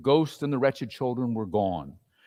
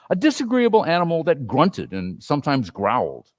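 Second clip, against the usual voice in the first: plain reading voice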